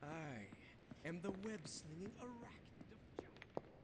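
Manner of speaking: whispery, menacing spider hero voice; creepy spider voice. Acted. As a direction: growl and hiss like a spooky spider. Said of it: "my spider voice".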